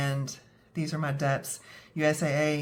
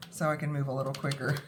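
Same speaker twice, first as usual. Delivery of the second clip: ordinary delivery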